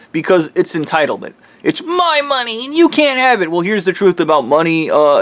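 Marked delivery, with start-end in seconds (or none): 1.6-3.5 high, whiny, put-on spoiled voice; mocking entitled voice. Mocking the rich man's entitlement: high whiny brat voice. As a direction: parody a spoiled rich man clutching his money.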